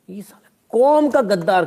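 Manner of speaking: a loud, indignant what-the; indignant disbelief. Real, not acted. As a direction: throw it out in baffled outrage. Incredulous reaction to the label.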